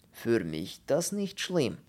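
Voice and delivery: slow, heavy foreign voice in broken German; slow Polish character voice. Acted. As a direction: drag the words out like a doleful Pole. Pole butts in, broken German.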